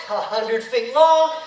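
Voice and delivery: usual speaking voice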